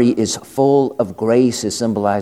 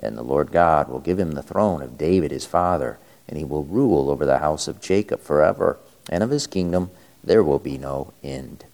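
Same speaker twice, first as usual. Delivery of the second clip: lower, solemn liturgical reading; Gospel reading; 3 semitones lower than usual. Acted. Priest reads the Gospel aloud.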